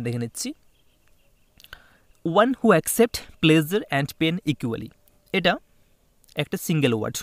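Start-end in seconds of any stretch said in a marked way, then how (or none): 2.3-4.9 very slow, word by word, louder; teacher dictating an English question to students, very slowly; slow dictation. Shown as a demonstration. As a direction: read it out slowly for the class to copy.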